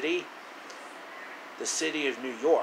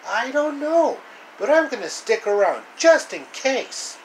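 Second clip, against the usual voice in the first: high, louder exasperated rant; fed-up hero voice; 8 semitones higher, 5 dB louder than usual. Acted. Hero complaining to friends about robot, high exasperated rant. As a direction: vent about the stupid robot.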